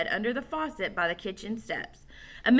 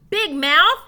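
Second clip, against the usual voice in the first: loud, higher yell; angry shout; 8 semitones higher, 11 dB louder than usual. A narrator's reading. Alan shouts from the horse stall at Joe. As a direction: bark it across the barn. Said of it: "shouted Alan from the horse stall".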